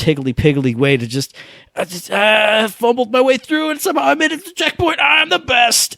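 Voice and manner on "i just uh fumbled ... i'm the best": giddy bragging. Acted. Triumphant brag about reaching checkpoint.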